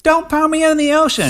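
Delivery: very high, pleading mock cry; panicked wife. Trapped wife begs her husband, high mock plea. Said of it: "be like".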